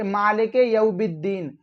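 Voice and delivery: यौमिद्दीन misread as "yaubiddeen"; quick misreading with b for m in यौमिद्दीन; mistaken b reading. Shown as a demonstration. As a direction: put a b in यौमिद्दीन, vowels unstretched. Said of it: "लोग बिद्दीन पढ़ते हैं".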